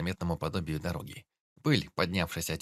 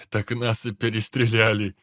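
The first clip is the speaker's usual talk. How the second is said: laughing; 2 semitones higher, 8 dB louder than usual. Acted. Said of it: "заржал опер".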